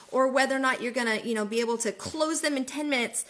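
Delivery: normal talking voice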